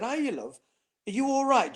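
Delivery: high, lightly voiced caring lady; a hint of a motherly neighbour calling out; kindly old lady. Lady calling to struggling runner, lightly voiced lady.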